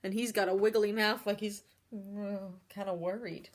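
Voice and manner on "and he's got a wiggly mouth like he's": plain reading voice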